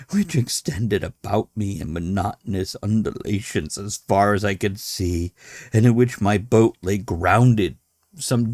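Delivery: measured, dramatic reading of a horror tale; story narration. A narrator's reading. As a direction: read it like a confession from a doomed man. Reads Dagon aloud to listeners.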